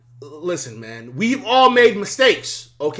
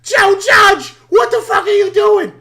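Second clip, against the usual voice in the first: high, loud re-enacted heckle from the stands; yelling and screaming; 10 semitones higher, 6 dB louder than usual. Fan re-enacting shouts at Joe Judge from his section, yelling. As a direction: yell it out like an outraged fan. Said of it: "I'm yelling and screaming".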